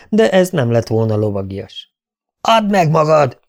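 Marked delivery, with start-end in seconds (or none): 2.4-3.4 a lightly voiced yell; mild roar of a knight. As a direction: demand surrender, raised voice. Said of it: "üvöltötte".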